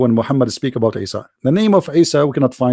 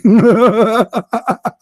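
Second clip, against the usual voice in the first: real laugh; 5 semitones higher than usual. Real, not acted. Laughing hard at the chat room's lie, a real laugh.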